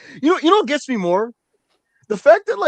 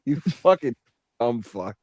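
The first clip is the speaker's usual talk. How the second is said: sarcastic put-down; 14 semitones lower than usual. Retold or imitated. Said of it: "It's like".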